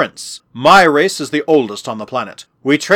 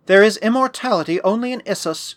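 put-on lofty young woman; 7 semitones higher than usual. Haughty goddess-born girl's dialogue in story.

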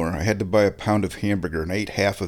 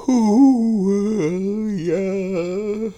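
high squeaky talk through a yawn; 12 semitones higher, 4 dB louder, much slower than usual. Real, not acted. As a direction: say it mid-yawn. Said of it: "why am I yawning".

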